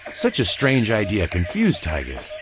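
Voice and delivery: usual speaking voice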